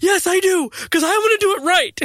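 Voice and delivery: put-on high, loud little boy insisting; imitating eager son. Son answering dad, eager in a high boy's voice. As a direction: pipe up like a determined kid. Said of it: "He's like".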